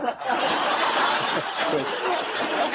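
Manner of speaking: rising burst of laughter; laughing. Real, not acted. Speaker laughing after the horse thief joke, tickled. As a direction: laugh at the punchline.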